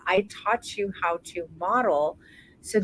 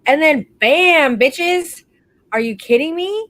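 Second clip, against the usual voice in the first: excited reveal; 6 semitones higher, 8 dB louder than usual. Real, not acted. Coach showing off the after photos, loud and thrilled. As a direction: burst out with delight.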